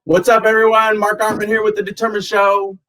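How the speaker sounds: upbeat host greeting, high and bright; show host intro. Acted. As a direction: welcome the audience like a podcast host.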